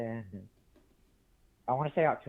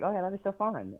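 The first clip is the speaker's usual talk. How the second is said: lightly voiced keen girl, pitched higher; imitated eager girl; 8 semitones higher than usual. Caller quoting the girl accepting a movie invite, lightly eager. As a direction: echo a friend's upbeat yes with a lift. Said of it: "was like".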